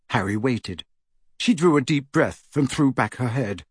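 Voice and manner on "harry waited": ordinary delivery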